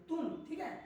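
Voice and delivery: normal talking voice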